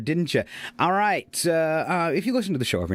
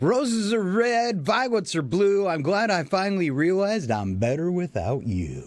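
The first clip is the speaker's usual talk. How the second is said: poem recital; 4 semitones higher than usual. Acted.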